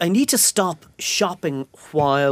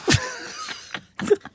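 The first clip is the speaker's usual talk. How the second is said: high snorting laugh; 5 dB quieter than usual. Real, not acted. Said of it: "There's the snort".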